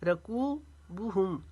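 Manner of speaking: रकूबुहुम very slow, बू kept short; slow recited रकूबुहुम; tajweed recitation. Shown as a demonstration. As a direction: chant रकूबुहुम, stretch कू only. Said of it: "बू को नहीं खींचेंगे".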